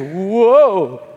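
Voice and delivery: loud, drawn-out startled whoa. Retold or imitated. The caught man reacts to being singled out, loud startled whoa. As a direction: jolt like you've been caught. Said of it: "And that guy goes".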